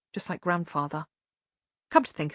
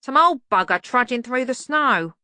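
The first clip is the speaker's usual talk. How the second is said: put-on higher, loud grumbling old man; cranky old servant; 5 semitones higher, 5 dB louder than usual. A narrator's reading. Albert voiced.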